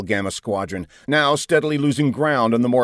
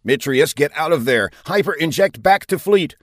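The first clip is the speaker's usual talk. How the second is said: higher, pressing commander's voice; urgent order; 6 semitones higher, 4 dB louder than usual. A narrator's reading. Commander ordering his fighter captain to retreat, urgent.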